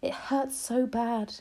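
slow pained voice; slow, hurting words. Acted. Injured mouse tells grandma.

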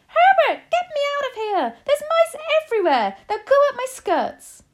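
shrill, panicked screaming, very high and loud; Missus Jenkins. A narrator's reading. Wife begs husband to save her from mice, screaming shrilly. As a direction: shriek in terror for rescue. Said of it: "it was screaming".

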